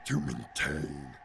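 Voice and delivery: slow, low, quiet put-on voice; low creature impression, very slow; strained character voice. Shown as a demonstration. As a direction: drag it out low and soft, straining the voice. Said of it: "To do that sound for too long".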